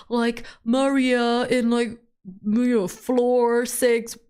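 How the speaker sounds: slow drawn-out mimic of the landlord; slow mimicking landlord; mocking landlord. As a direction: stretch it out mockingly. Mocking landlord name dropping.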